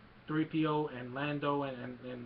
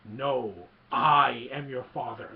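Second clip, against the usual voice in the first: slow, loud, weighty villain reveal; dramatic mock-solemn pronouncement; Vader; 9 dB louder, slower than usual. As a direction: imitate a movie villain, booming the "I". Acting out Vader's big reveal.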